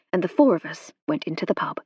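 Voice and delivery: usual speaking voice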